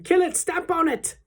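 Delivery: high, frantic yells; high-pitched shouted orders in the chase; urgent shouting. A narrator's reading. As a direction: egg the mob on at full pitch. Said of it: "they were shouting".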